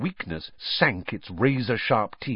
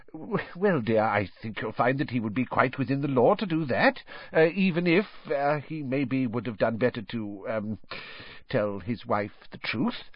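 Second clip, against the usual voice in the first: hesitant, stammered excuse; sheepish husband; 3 semitones higher, 3 dB quieter than usual. Acted. Husband excusing himself to his wife, sheepish and hesitant. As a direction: falter and hedge, softer as it trails away.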